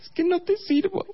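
tiny falsetto mock sulk; offended girlfriend. Girlfriend offended at being called useless, mock sulky falsetto. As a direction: pout in a very high put-on female voice. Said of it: "se enojó, por supuesto que se enojó".

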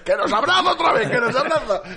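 high-pitched comic alarm; hammed-up panicked cry; mock panic. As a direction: squeal like trees fleeing a hugger, half laughing. Voicing trees fleeing the hugger.

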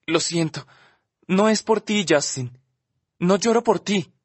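a touch of a whimper, voice lifted. Acted. Olivia apologising to Justin through tears, mildly whimpering. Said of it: "dice por fin gimoteando".

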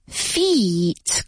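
"Feet" with a long drawn-out ee. Shown as a demonstration. Teacher showing the long i, "Feet" held long. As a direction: stretch the vowel in "Feet". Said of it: "como si fuera una I larga".

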